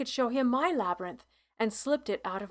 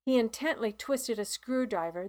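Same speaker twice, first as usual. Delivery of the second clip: plain reading voice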